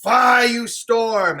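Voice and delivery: slow raging cry at the storm; screaming at the storm. Acted. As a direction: hurl it at the heavens. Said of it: "he's screaming at the heavens".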